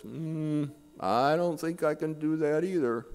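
hum, then refuse from on high; voice from heaven. Acted. God refusing the atheist a second time, lightly played. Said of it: "this big voice that came from the heavens".

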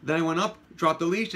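normal talking voice

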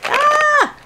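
high-pitched delighted squeal; excited scream. Real, not acted. Thrilled by a gift, excited scream. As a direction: let out a short high scream. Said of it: "wrecking your ears".

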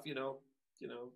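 ordinary talk, nothing marked

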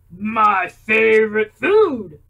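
loud, slow monster voice. Acted. Gruffalo tells the mouse it's his food. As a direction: growl it like a big hungry beast.

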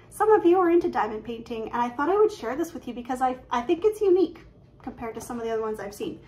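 x1.6 fast, jittery rush of words; nervous fast talk. Real, not acted. Stitcher tells viewers about diamond painting, rushing nervously. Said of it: "I'm just talking really fast".

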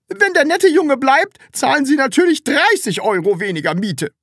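high, loud offer; flustered, high-pitched shout; loud insisting. A narrator's reading. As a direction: yell generosity as if scolding. Flustered landlady yelling another offer.

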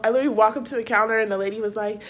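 ordinary talk

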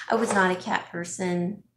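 usual speaking voice

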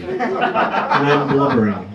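drawn out, half-laughing admission of blubbering. Retold or imitated. Telling the crowd how he cried at breakfast, slow and laughing. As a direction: stretch it out slowly with a laugh. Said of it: "I'm not exactly the blubbering type".

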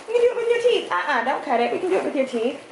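ordinary talk, nothing marked

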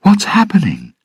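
very high, urgent question; alarmed question. Acted. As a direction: call out in alarm. Bride calling to arrested groom.

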